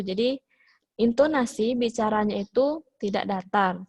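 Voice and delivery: ordinary delivery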